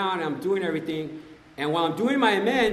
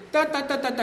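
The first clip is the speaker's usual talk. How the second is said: high-pitched nonsense yapping; mock squabbling back; 9 semitones higher than usual. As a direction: fire back in mock argument. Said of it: "you wanna get down?".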